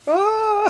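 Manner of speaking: high-pitched imitation scream; mock scream. Mimicking the scared girls of horror manga, high mock scream.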